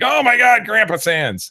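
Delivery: high, loud mock shriek of horror; freaked-out kids. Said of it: "my boys are always freaked out by it".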